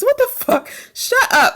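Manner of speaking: high disbelieving what the fuck; incredulous. Real, not acted. Mocking men's line, high incredulous scoff.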